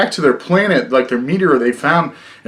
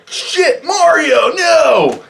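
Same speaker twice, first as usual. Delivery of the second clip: much higher, shouted cry of despair; mock anguished shout; 11 semitones higher, much slower than usual. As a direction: yell it like watching Mario die. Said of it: "You're like".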